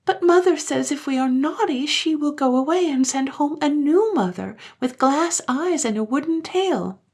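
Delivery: put-on high, eager voice of small children; children's voice. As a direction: play the pleading little ones. Children's voice held.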